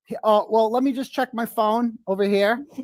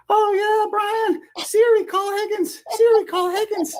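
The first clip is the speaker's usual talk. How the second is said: much higher, hammed-up falsetto moaning; mock woman's moans; 14 semitones higher than usual. Voicing the doll as the woman moaning to Brian, high hammed-up falsetto.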